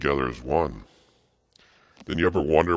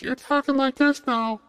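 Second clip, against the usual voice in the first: very high, loud falsetto squeak; surgically altered voice; 6 dB louder than usual. Shown as a demonstration. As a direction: jump way up into a mock surgically feminized voice. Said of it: "instead of talking like this".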